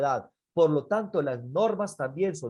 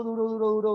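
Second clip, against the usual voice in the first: "duro" repeated higher, like blow after blow; rapid-fire "duro"; 6 semitones higher, much faster than usual. Retold or imitated. Preacher recounts child's blows, insistent raised "duro". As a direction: hammer each "duro" in a run.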